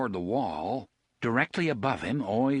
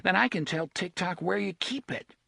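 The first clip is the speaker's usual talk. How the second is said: put-on boy voice; 8 semitones higher than usual. Narrator voices Jake accusing Gasher.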